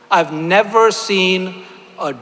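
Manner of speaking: "never" drawn out slow and leaned on; slow down and weigh "never"; a speaker stressing never to the audience, slow emphasis. Real, not acted. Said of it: "I want to emphasize the word and never".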